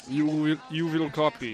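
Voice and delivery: halting mock Russian accent; bad Russian accent. Host retries the Russian's sign-off for cohost, bad Russian accent. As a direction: give a short order in a put-on Russian accent. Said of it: "That was pretty good".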